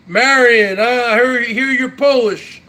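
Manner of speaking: ordinary delivery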